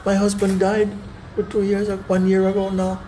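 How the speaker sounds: high, plaintive widow's complaint; lamenting widow, high. Retold or imitated. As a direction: tell your misfortune in a high lament. Widow explains husband died, begging.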